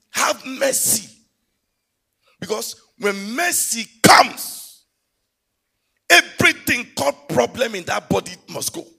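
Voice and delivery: loud, slow, half-sung preaching; high, loud, drawn-out sermon with music; full-force preaching. Acted. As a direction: build to the pulpit climax.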